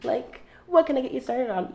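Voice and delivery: very high chirpy barista greeting; customer service voice. Retold or imitated. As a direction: bubbly counter voice, flirty cheer. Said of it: "So it was like".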